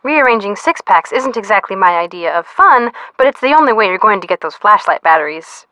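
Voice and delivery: ordinary talk, nothing marked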